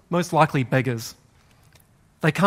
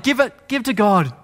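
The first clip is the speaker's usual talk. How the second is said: louder, higher calling out; beggar's yell; 6 semitones higher, 4 dB louder than usual. Acted. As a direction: challenge passersby with a shout. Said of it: "They would yell out".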